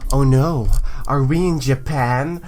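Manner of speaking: ordinary delivery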